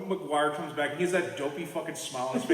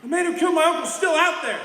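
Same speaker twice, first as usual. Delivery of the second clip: angry shout; 10 semitones higher, 8 dB louder than usual. Retold or imitated. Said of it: "I'm like".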